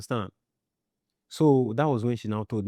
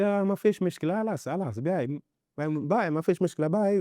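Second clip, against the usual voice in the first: imitating a high wailing goodbye, much higher; lightly acted lament, pitched high; mock wailing farewell; 7 semitones higher than usual. Retold farewell cry from boat.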